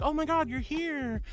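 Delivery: high-pitched delighted reenactment; happy greeting. Retold or imitated. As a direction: act out thrilled recognition of a guest. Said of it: "I always get really happy when people show up".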